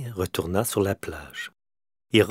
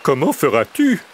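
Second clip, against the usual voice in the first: higher, louder, thrilled question; delighted; 7 semitones higher, 7 dB louder than usual. A narrator's reading. Little bear asking the crow, delighted and high. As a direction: ask it overjoyed. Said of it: "demanda Petit Ours ravi".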